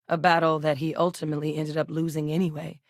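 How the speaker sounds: ordinary talk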